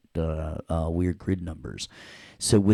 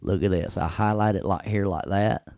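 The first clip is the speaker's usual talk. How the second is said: playful Southern twang; hammed-up Southern US accent. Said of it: "You do that Southern accent way too good".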